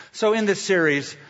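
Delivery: ordinary talk, nothing marked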